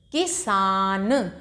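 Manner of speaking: किसान drawn out slowly, syllable by syllable; slow classroom blending; slowly blended word. Shown as a demonstration. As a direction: stretch किसान slowly like a teacher sounding it out. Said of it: "तोड़ तोड़ के पढ़ेंगे".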